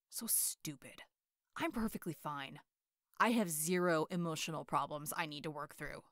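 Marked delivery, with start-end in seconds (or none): 0.1-2.6 whispered grumble. Acted. Grumbling to himself.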